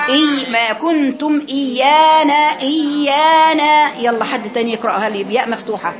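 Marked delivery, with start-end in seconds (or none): 0.1-4.0 slow, louder and higher إيانا; slow tajweed repeat. Shown as a demonstration. Teacher repeats the student's reading, slow and louder. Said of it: "أحسنتي".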